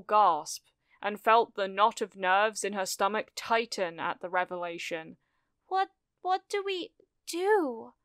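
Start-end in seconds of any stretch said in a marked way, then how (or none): 5.7-7.9 high-pitched, halting, anxious plea; helpless. A narrator's reading. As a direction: stammer the question helplessly, pitch way up. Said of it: "she asked helplessly".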